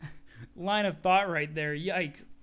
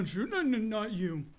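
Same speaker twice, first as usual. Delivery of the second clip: ordinary talk, nothing marked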